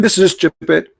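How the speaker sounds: ordinary talk, nothing marked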